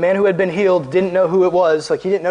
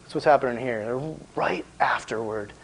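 usual speaking voice

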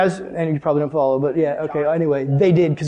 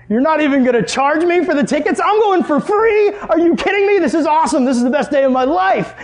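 thrilled; 9 semitones higher than usual. Retold or imitated. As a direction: gush like the best day ever. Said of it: "I'm getting really excited at this point".

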